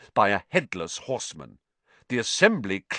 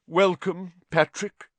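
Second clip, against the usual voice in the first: stiffly polite, raised pitch; 8 semitones higher, 4 dB louder than usual. A narrator's reading. Nick welcoming an unwanted Sir Patrick, stiffly. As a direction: greet him with stiff formality. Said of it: "said Nick stiffly".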